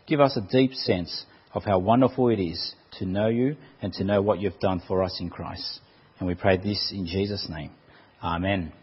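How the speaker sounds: soft, low prayer voice, settling the room. Acted. Pastor praying before the sermon, soft and reverent. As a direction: pray quietly and reverently to God.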